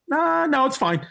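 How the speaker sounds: unconvincing reassurance. Retold or imitated. Agent judging the comic's suit, falsely reassuring. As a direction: reassure while really meaning yes. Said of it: "he wanted to say yes".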